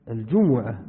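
الجمعة set apart as al-ju-MU-'a, Quranic form; damma on the mim. Shown as a demonstration. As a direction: sound a clear u after the mim in الجمعة. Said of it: "بضم الميم". (الجمعة بضم الميم)

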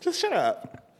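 high-pitched, incredulous shut-up; comic high-pitched exasperation; exasperated dismissal. Acted. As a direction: dismiss them in a squeaky high voice. Mock telling-off of an absent celebrity.

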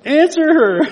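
high, loud mock shout at the mom; half-laughing exasperated outburst; exasperated yell. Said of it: "and I'm like".

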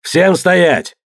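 high, forceful order to halt; commanding shout. A narrator's reading. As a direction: stop the whole crew with one call. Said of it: "крикнул Тьор".

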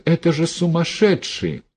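high, tearful disbelief; helpless high-pitched alarm; distraught. A narrator's reading. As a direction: exclaim high, near tears. Tears boiling in her throat.

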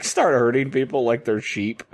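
normal talking voice

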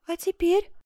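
high-pitched outburst of a question; sudden cry. A narrator's reading. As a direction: cry it out, straight from the heart. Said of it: "Крик этот, вырвавшийся из груди Коломбы". (внезапный вскрик)

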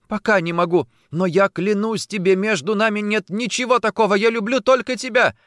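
high, loud, urgent vow; impassioned pleading. A narrator's reading. Woman pressing hands to chest swears love, pleading. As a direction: swear it desperately.

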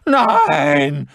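high, loud shriek of terror; long terrified scream. A narrator's reading. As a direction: screech it out in panic. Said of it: "kreischte der Mann".

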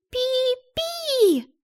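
very high, unsure chirp; vague bird chirp. Acted. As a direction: chirp without committing. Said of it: "неопределённо ответила она".

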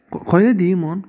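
ordinary delivery

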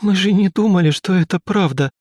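put-on high, tearful sobbing girl; crying out the words; through tears. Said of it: "сквозь поток слёз проговорила она". (сквозь поток слёз)

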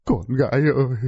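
a lightly voiced wail for his daughter; understated grieving cry; wailing father. A narrator's reading. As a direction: call out to the lost daughter, mildly. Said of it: "đứng ngoài mà gào khóc".

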